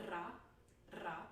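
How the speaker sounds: ra with a rolled trilled r; rolled r demo. Shown as a demonstration. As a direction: roll the r in ra. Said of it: "This is a rolled R".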